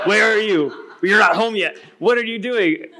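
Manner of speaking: a hint of a worried nagging wife, through a laugh; mock nagging wife. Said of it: "I think I might know what I'm walking into".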